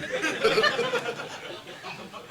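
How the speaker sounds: high, airless burst of laughter; breathless laugh. Real, not acted. Speaker laughing at his own joke to the audience, high and breathless. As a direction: let a silent wheezing laugh out.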